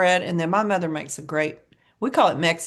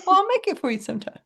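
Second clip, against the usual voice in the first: laughing promise; 13 semitones higher, much faster than usual. Real, not acted.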